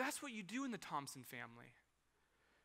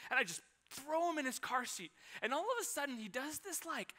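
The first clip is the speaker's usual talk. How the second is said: ordinary talk, nothing marked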